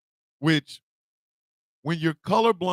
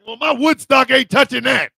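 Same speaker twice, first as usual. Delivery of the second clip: excited mock quote; 8 semitones higher, 8 dB louder than usual. Mock quote while laughing.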